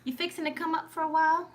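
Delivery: put-on Southern folksiness; fake Southern accent. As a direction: ham up a Southern accent, pitched high. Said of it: "with a fake Southern accent".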